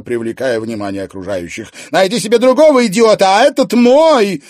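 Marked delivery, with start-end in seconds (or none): 1.9-4.4 high, loud, fast furious tirade; jealous rant. A narrator's reading. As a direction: tear into her rival. Jealous wife threatens rival.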